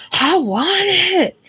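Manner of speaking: put-on high, slow childish whine; drawn-out mock kid whine; whiny child demand. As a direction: mimic a kid demanding a toy.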